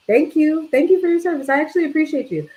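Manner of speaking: high, bright-eyed thank you; excited thanks. Acted. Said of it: "I'm excited".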